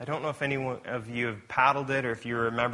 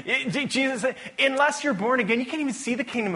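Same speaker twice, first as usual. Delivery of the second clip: excited quoting; 12 semitones higher, 3 dB louder than usual. Retold or imitated. Jesus quoted with excited lift.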